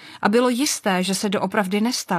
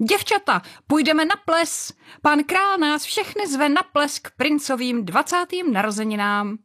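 high, thrilled news of the ball; excited announcement; 6 semitones higher than usual. A narrator's reading. Stepmother announces the royal ball to her girls, thrilled and high. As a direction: call it out like the best news ever.

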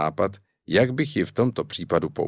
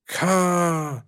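exclaim; 9 semitones higher than usual. A narrator's reading.

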